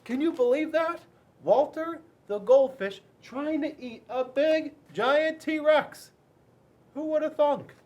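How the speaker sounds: high, loud, wide-eyed disbelief; amazed storyteller. A narrator's reading. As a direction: marvel at it for the kids. Narrator marvels to children.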